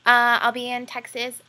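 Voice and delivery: talking while chewing gum; words around a wad of gum. Real, not acted. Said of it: "I'm chewing gum".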